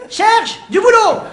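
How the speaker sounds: slow shouted mock boss. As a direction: bellow it slowly like a pompous boss. Mock job interview with spectator.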